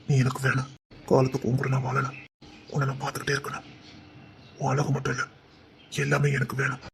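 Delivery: low, soft murmur; hushed, low-voiced yearning; intimate longing. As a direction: speak low and softly, savouring each desire. Man privately voicing his longing.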